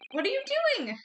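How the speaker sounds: jumps way up in pitch, alarmed; startled alarm. Real, not acted. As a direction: caught off guard, voice shooting high. Character suddenly drinks the poison.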